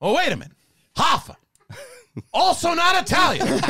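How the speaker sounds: high-pitched movie-star rant in separate bursts; Al Pacino. Acted. Actor argues with his director over casting, high Pacino impression. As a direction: climb high in mock indignation, dip, rise again. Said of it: "Pacino just went from being like that really high voice".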